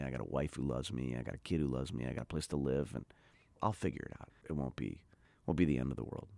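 ordinary talk, nothing marked

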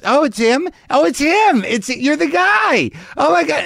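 high, squealing starstruck fan; excited fan woman. Retold or imitated. Drunk woman outside spotting the comic, squealing high. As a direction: gush like a tipsy fan spotting him. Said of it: "I hear this woman look at me and goes".

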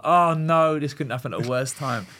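high, loud burst of retold dismay; mock-panicked oh no. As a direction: blurt out the panic, then settle. Dismay at bad news, retold.

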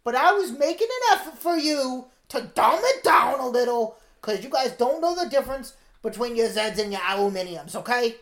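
high, loud mock British orphan; exasperated comic British urchin voice; put-on British accent. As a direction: lecture them in a hammed-up British accent. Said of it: "listen to that British accent".

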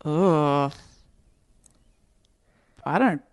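normal talking voice

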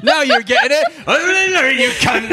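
high, loud, gleeful barman; loud, high, cheerful barman's shout; delighted barman. Acted. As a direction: crow it out with delight.

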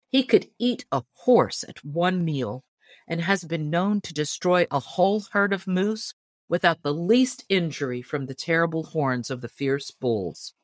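ordinary delivery

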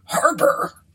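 high, startled landlord's query; incredulous, pitched sharply up; astonished cry. A narrator's reading. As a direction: cry it out in rustic disbelief. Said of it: "cried the landlord".

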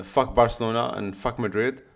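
ordinary talk, nothing marked

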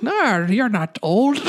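slow, hammed-up Irish accent; bad Irish accent. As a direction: put on a terrible Irish brogue. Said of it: "my Irish accent is not even".

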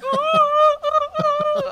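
very high, loud wordless "ooh"s; scared oohing. Retold or imitated. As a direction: imitate someone too frightened to speak. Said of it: "She was too scared to talk".

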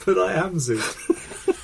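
slow, laughing protest. Real, not acted. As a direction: drag it out while laughing.

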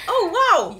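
high, loud, exaggerated shock; hammed-up incredulous exclaiming; mock amazement. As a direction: act out naive astonishment. Mocking her younger, clueless self.

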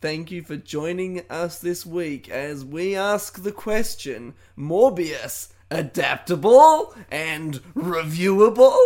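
loud, slow show announcement; announcer voice. Acted. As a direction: boom it out like a host. Announcing the show's question.

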